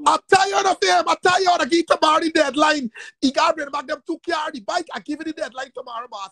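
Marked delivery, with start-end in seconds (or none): 0.1-4.5 higher, louder shouted ultimatum; shouted phone ultimatum; angry shout. Real, not acted. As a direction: lay down the deadline furiously.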